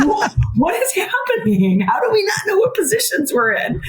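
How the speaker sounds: high-pitched, incredulous coach at a loss; baffled exclamation. Retold or imitated. Coach retelling confusion over the botched lineup, baffled and high-pitched.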